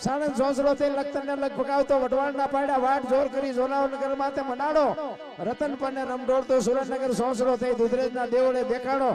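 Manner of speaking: loud, high-pitched chanted folk verse; rousing poem recital. Acted. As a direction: belt out the verses on a high, driving, level pitch. Recites a folk poem about the earthquake.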